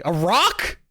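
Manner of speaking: loud, high, rising mock question; imitating own baffled outburst; mock disbelief. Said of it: "I was like".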